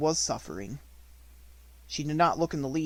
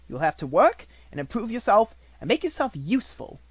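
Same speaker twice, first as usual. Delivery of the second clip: louder, harsh headmistress voice; domineering schoolmistress, louder; stern Miss Minchin; 8 semitones higher, 8 dB louder than usual. A narrator's reading. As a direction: order it coldly.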